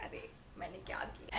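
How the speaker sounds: soft, half-whispered protest; दबी आवाज़ में हैरानी; hushed retort. Retold or imitated. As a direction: mutter it under your breath, baffled. Confused retort to mom's accusation.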